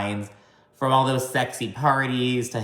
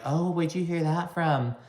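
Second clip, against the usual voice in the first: high, airy mock denial; lightly voiced coy girlfriend; mock innocent girl; 6 semitones higher, 4 dB quieter than usual. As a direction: play dumb in a put-on voice. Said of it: "She was like".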